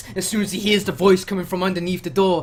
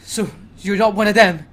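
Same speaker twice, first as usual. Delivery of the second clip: distraught; 6 dB louder than usual. Acted. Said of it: "looking completely distraught".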